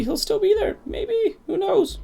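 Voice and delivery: very high falsetto musing; squeaky falsetto. Pretend. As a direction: lift it way up into head voice, hopefully.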